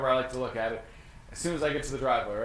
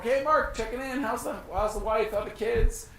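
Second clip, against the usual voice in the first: much higher, bright chummy phone greeting; friendly check-in call; 8 semitones higher than usual. Pretend. Agent phoning past client Mark, cheery and much higher. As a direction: play the upbeat buddy calling an old client. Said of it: "I'm gonna reach out to Mark".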